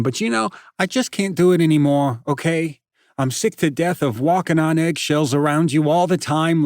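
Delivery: loud, high, fast taunting rant; bully mocking a hurt friend; cruel tirade. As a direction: tear him apart, enjoying it.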